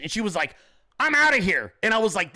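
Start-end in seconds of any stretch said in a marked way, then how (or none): 1.0-1.7 louder, higher imitation of storming off; mock indignant walkout; huffy exit. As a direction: toss it out as a parting shot. Retold wife storming off.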